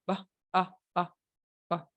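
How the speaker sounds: short relaxed uh from a loose mouth; schwa sound. Shown as a demonstration. Teacher showing students the schwa vowel. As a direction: breathe out a lazy uh. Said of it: "Esto es un schwa sound".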